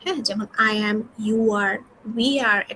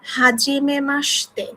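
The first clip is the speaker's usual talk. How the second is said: Hajime মাসতে said slowly, syllable by syllable; teacher sounding out Hajime মাসতে slowly for the class; slow model phrase; 2 semitones higher, much slower than usual. Shown as a demonstration. Teacher models a Japanese greeting slowly for students. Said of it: "আপনারা এটা একটু খেয়াল করবেন".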